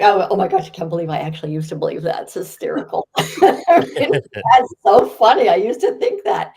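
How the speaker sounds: put-on laughing at your old self; amused disbelief. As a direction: act out finding it hilarious, breaking into laughter. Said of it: "have a sense of humor about it".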